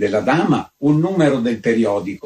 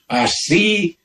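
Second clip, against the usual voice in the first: shouted; 7 semitones higher than usual. A narrator's reading. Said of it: "gridò".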